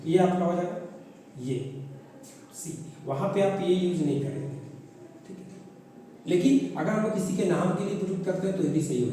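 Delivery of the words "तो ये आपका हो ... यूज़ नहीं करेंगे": normal talking voice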